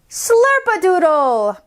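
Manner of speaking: strong, carrying voice, slightly drawn out; strong speaker voice. Shown as a demonstration. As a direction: project it to a group without yelling. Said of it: "find the voice that is halfway between your table talk voice and".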